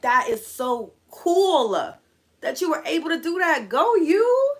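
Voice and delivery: high, loud gushing admiration; drawn-out, amazed praise rising to a cheer; thrilled cheering. Retold or imitated. Child cheering on the friend who won, gushing.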